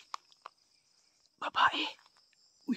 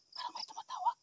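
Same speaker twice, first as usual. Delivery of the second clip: "Parang may tumatawag" breathed as a voiceless whisper; 10 dB quieter than usual. Real, not acted. Hearing a call in the dark, he whispers. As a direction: hush so whatever calls won't hear. Repeats line, then whispers it.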